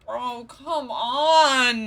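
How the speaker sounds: loud, high, drawn-out cry of dismay; real frustrated outburst at the game; exasperated protest. Real, not acted. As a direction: wail it out in disbelief. Protests the game's plot twist.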